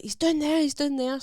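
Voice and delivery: retold lookout raising the alarm; urgent alert.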